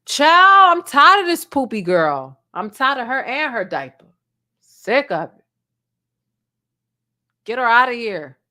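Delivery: loud, fed-up sassy mother; exasperated mom voice. Acted. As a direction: complain loudly like a tired mama. Acted exasperated mother rant.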